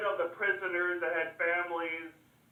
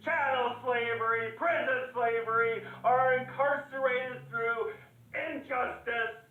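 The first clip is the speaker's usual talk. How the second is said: impassioned oratory; 5 semitones higher than usual. Acted. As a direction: preach against it, hammering each item. Orator denounces slavery to crowd.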